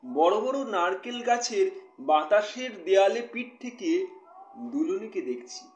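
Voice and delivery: expressive poetry reading with measured pauses; poem recitation. Acted. Poet reading a new poem to live viewers, expressive recitation. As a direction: recite it line by line, letting key words swell.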